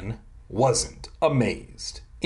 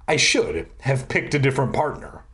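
usual speaking voice

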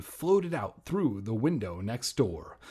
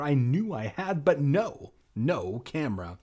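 normal talking voice

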